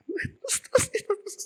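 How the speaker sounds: very high, squealing plea to go on; excited urging. Acted. Wife eagerly urges story on. (istri sangat penasaran, memekik dengan suara tinggi)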